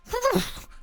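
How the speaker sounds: short, high uneasy animal noises; imitated high-pitched whimper of discomfort; discomforted animal sounds. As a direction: imitate an uncomfortable beast in quick high bursts. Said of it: "somewhat discomforted sounds".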